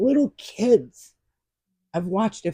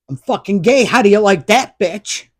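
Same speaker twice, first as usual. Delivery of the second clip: imitating a furious son, loud and high; retold angry shout from the son; son's angry outburst; 4 semitones higher, 9 dB louder than usual. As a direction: throw it back at your mother like a mock yell. Son gets mad in argument.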